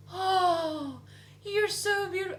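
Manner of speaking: high gushing granny voice; sweet old lady. Retold or imitated. Wife imitating an old lady praising her. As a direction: coo like a doting grandmother.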